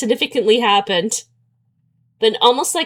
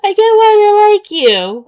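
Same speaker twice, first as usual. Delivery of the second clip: high, loud, mocking shout of the line; exasperated mock; 11 semitones higher, 8 dB louder than usual. Fed up with the same compliment, high loud mockery. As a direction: throw the line back, sick of it.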